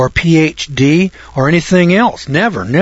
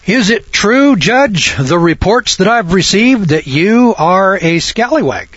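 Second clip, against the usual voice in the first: high, drawn-out mock-formal inquiry; careful questioner; 6 semitones higher, slower than usual. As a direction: put it to the judge as a careful, elevated question. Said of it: "asking the judge if the reports".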